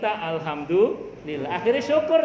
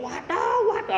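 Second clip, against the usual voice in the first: high mock yelp of toothache; pura-pura teriak kesakitan; 10 semitones higher than usual. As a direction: lightly act out a cry of pain. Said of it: "terus teriak-teriak". (teriakan pura-pura, nada tinggi)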